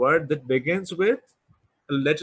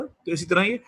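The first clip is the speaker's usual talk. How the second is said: normal talking voice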